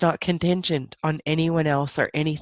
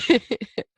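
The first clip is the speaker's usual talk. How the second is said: a bright, higher laugh of realization; moved laugh; 7 semitones higher, 3 dB louder than usual. Real, not acted. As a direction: laugh as it dawns on you.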